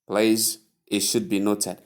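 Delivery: ordinary talk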